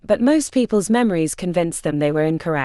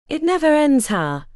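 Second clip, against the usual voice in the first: put-on a light foreign accent, friendly small talk; Emre's accent; 7 semitones higher than usual. A narrator's reading. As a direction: ease in with a hint of a foreign accent. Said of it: "a faithful recreation of Emre's accent".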